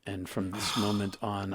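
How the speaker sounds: ordinary talk, nothing marked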